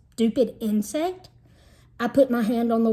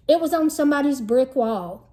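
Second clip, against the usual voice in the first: usual speaking voice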